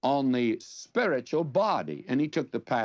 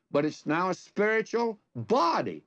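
raised pitch, "body" set apart with a firm fall; stress on body; 6 semitones higher than usual. Retold or imitated. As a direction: punch "body" rather than "spiritual". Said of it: "the emphasis is on body".

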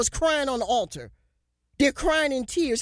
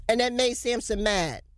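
ordinary talk, nothing marked

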